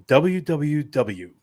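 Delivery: slow, deliberate radio announcer. Pretend. Host repeats the website after hearing himself, radio voice. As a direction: draw out each letter like a station plug. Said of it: "that sounded very radio".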